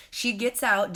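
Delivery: ordinary talk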